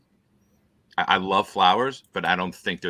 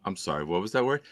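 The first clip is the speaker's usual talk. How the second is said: normal talking voice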